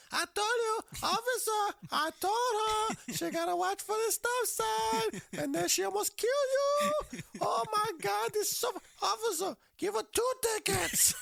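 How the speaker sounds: very high, loud Polish mother impression; Polish accent. Mother in back seat to officer, shrill mock Polish accent. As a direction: shriek it in a hammed-up Polish accent, cracking up. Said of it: "it was Polish accent".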